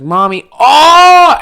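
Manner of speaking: high, loud, slow "Mommy" then caught out; mock kid blurting. Kid calling the teacher mommy by accident, high and slow. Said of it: "calling your teacher mom".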